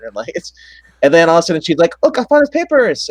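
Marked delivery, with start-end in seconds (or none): 2.0-3.1 high squeaky falsetto announcement; mock wife's voice. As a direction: squeak it like a silly woman with news. Wife voiced in retold scene.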